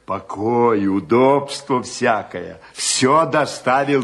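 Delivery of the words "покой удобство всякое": ordinary talk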